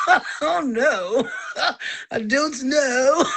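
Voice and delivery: giggling through the words; laughing. Real, not acted. Amused by her own pimple, laughing.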